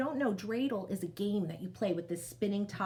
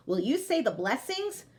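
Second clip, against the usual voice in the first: ordinary delivery, nothing marked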